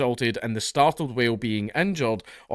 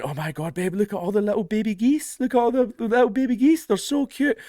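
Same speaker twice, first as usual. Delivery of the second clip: much higher, cooing imitation of his wife; gushing wife; 10 semitones higher than usual. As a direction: coo over the baby geese like his wife. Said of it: "and she went".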